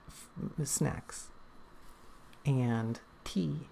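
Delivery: soft murmured snacks and tea; hushed aside. Real, not acted. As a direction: drop to a quiet, fond aside. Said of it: "I prefer single syllable words".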